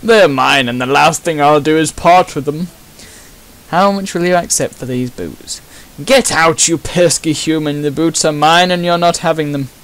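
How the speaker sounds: put-on loud, haughty upper-class English accent; a snooty toff scoffing at a lowly human; posh toff accent. Rich homeowner refusing to sell his boots, in a posh toff voice. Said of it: "posh toff".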